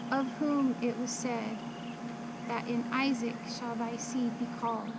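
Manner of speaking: ordinary delivery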